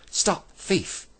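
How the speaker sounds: high, ringing cry of alarm; loud shout. A narrator's reading. Joe catching a thief at the bag, shouting loudly. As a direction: yell it out to halt a thief. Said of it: "He shouted loudly".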